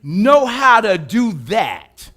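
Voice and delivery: loud, slow, commanding voice of God; God's booming voice. Acted. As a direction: thunder it down as God. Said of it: "And God said".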